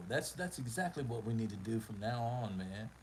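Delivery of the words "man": ordinary talk, nothing marked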